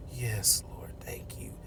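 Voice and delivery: breathless whispered thanks; grateful whisper. Retold or imitated. Captive girl thanking the Lord for his offer, whispered. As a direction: whisper it like a prayer.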